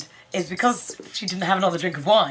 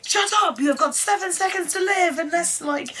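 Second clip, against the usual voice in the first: high, hammed-up menacing snap; mock menace; mock threat; 11 semitones higher than usual. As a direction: threaten in a playful mock-tough voice. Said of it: "Mari's just like".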